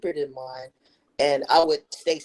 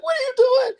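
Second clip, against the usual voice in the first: mock friend complaining; 9 dB louder than usual.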